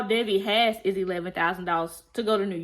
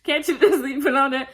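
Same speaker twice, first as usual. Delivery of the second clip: louder and higher, cracking up; laughing repeat; 5 semitones higher, 6 dB louder than usual. Retold or imitated. Repeating the friend's joke about the car, laughing loudly. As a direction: echo the joke while laughing hard. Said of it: "I said".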